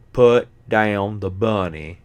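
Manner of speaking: slow, overdone Southern drawl; mock a bad movie Southern accent; hammed-up Southern accent. Mocking Nicolas Cage's fake Southern line, hammed-up Southern accent. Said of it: "Nicolas Cage is hamming it up".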